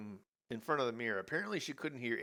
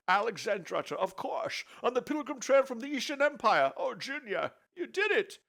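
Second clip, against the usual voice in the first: put-on old father voice; 12 semitones higher, 6 dB louder than usual.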